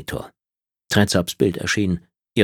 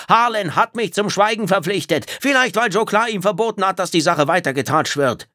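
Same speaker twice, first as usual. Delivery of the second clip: viel höher und lauter, außer sich; verzweifeltes Schreien; 13 semitones higher than usual. Acted. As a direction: schrei sie an, als ginge es um Leben und Tod. Phil schreit, flunkert. (Phil brüllt die Reptiloidin verzweifelt an)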